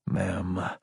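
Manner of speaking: soft, restrained address; self-correcting. A narrator's reading. Lieutenant correcting himself to his superior, restrained. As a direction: pull back to a quiet formal tone. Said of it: "he corrected himself".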